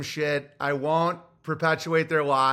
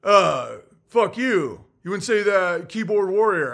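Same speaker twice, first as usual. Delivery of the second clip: a higher, louder sneer, lightly voiced; sneering mock voice; 3 semitones higher, 5 dB louder than usual. Quoting the guy who called him a keyboard warrior, mock sneer. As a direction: mimic the rude guy tossing off an insult. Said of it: "And he's like".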